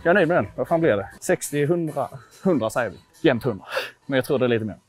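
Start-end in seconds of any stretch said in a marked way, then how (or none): none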